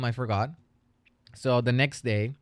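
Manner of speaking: ordinary talk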